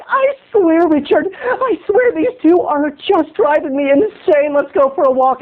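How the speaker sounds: exasperated date. Retold or imitated. Leah complaining to Richard, high exasperated whine. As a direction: sigh it out like someone at the end of her rope. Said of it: "Leah was like".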